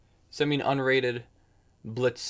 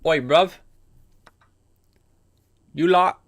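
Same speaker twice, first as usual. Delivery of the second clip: loud, high attempt at a British accent; hammed-up British lad greeting; bad British accent; 6 semitones higher, 8 dB louder, slower than usual. Shown as a demonstration. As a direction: put on a badly faked British street accent. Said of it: "Do a British accent?".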